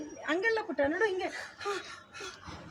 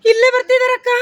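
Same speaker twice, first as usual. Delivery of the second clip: high, loud projected warning; loud demonstration for an actor; urgent call; 4 semitones higher, 7 dB louder than usual. Shown as a demonstration. Director models urgent line for child.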